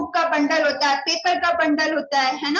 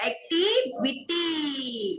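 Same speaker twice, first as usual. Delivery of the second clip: drawn-out answer; much slower than usual. Shown as a demonstration. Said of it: "repeated answer word".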